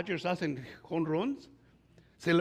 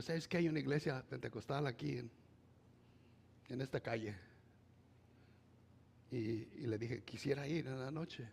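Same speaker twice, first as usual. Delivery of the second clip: hushed confiding; 4 semitones lower, 9 dB quieter than usual. Retold or imitated. Retold confiding to Mickey.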